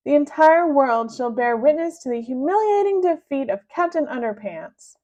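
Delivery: loud gloating threat; villain threat. Acted. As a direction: gloat over the beaten hero. Melvin threatens.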